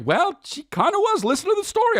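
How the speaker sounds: very high whiny pleading; whiny defense. Retold or imitated. Excusing himself to his wife, very high whine. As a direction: squeal it defensively.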